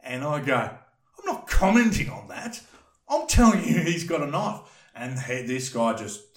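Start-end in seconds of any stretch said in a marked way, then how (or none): 0.6-4.6 refuse, then insist hard; upset insisting. Retold or imitated. To the police officer, high upset insisting.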